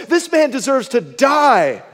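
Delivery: slow, loud condemning verdict; David's righteous fury; outraged verdict. Retold or imitated. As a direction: pronounce the sentence in outrage. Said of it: "he says".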